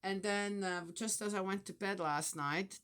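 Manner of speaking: ordinary delivery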